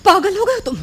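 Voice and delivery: high, alarmed outburst; appalled. Acted. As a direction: cry out in horrified protest. He proposes joint suicide.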